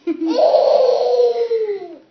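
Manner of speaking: big burst of real laughter; loud laugh. Real, not acted. Parent laughing loudly with baby.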